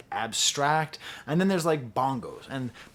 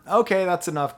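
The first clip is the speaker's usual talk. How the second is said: louder, higher cut-off; mock dismissal; 4 semitones higher, 6 dB louder than usual. Bandleader stopping the saxophonist, loud mock dismissal. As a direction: cut the player off abruptly, in play.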